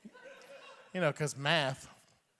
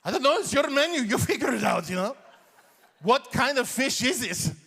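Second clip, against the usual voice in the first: higher, louder indignant dad with a laugh; exasperated dad; 7 semitones higher, 4 dB louder than usual. Retold or imitated. Dad snapping at the waiter over the menu, indignant. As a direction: throw the question back at him.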